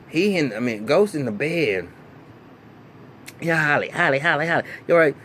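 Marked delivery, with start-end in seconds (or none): 3.4-5.2 higher, louder mock call to someone unresponsive; calling out her name. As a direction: call her name, then ask loudly if she's okay. Calling to dead Holly.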